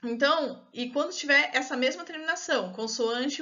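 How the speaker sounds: ordinary talk, nothing marked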